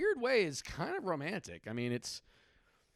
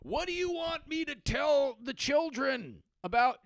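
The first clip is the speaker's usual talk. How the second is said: high, drawn-out plea from afar; mock-distraught spouse, pitched way up; panicked spouse calling out; 10 semitones higher than usual. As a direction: wail it slowly at a reckless partner. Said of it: "just being like".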